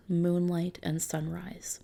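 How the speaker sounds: ordinary delivery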